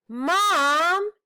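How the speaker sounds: high, loud little-brother call; kid brother calling out. Acted. Little brother butting in to tease his sister, high call. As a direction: cut in to get Mom's attention. Stink cutting in.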